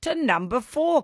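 high, loud burst of delight, then easing; delighted congratulations. Real, not acted. Host congratulating a quiz caller on moving on, excited. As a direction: cheer them on with excitement.

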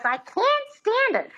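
high squeaky repeat of the line; shrill Lina Lamont whine. Retold or imitated. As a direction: squeak it back like the hopeless pupil. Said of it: "And she goes".

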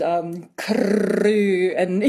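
crew with a long rolled trilled R, slowly; rolled R demo. Shown as a demonstration. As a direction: roll the R in crew hard, like a stage Scot. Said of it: "really roll their R's".

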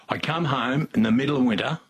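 ordinary talk

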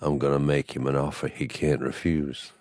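quietly menacing, like a hushed godfather; hushed mob boss. Pretend. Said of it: "say in a slightly hushed tone".

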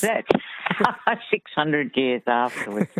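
slow words broken by laughter; laughing. Real, not acted. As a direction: laugh through the words at your own mistake. Laughing at her own wrong guess.

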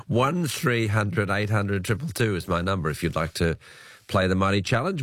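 usual speaking voice

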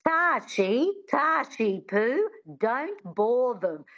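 high, drawn-out, lilting mother voice; impersonation of mother. Acted. Daughter mimicking her mother, high slow impersonation. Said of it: "her impersonation".

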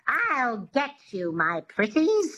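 drawn-out witchy threat; a light witch twang demo; Wicked Witch twang. Shown as a demonstration. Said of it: "Just say that".

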